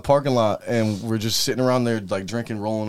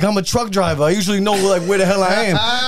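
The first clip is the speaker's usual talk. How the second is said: higher mock of the dazed trucker; mock lost trucker; 7 semitones higher than usual. Retelling the trucker at the casino, higher. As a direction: do the lost trucker higher than your own voice.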